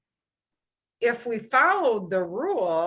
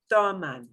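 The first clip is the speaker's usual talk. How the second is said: Toman with TO punched, falling at the end; stress on first syllable. Shown as a demonstration. Teacher drilling second to last syllable, first-syllable stress on Toman. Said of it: "it's second to last syllable".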